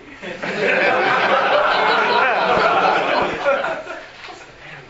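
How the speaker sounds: laughing at the story's punchline; high laugh. Real, not acted. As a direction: break into high, helpless laughter.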